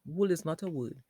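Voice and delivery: ordinary delivery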